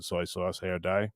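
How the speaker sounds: soft, low muttered repeat; quiet mutter. Real, not acted. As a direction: mutter it under your breath. Repeating the taunt to himself.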